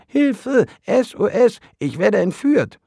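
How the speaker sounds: very high, very loud squealing cry; panicked cry for help. Acted. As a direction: shriek for help in mock panic. Worm being pulled out.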